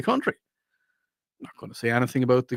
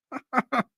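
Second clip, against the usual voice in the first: short laugh; 10 semitones higher, 3 dB quieter than usual. Real, not acted. Host laughs at himself.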